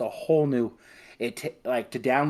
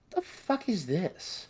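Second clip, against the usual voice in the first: slow, high, baffled; a slow bewildered what-the; stunned disbelief; 9 semitones higher, 4 dB quieter, slower than usual. Retold or imitated. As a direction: ask it like you can't believe the box. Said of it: "I was like".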